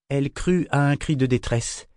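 ordinary delivery, nothing marked

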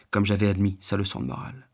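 plain reading voice

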